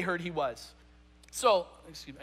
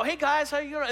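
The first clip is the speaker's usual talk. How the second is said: cheery greeting; 6 semitones higher than usual. Retold or imitated. Jesus greeting disciples.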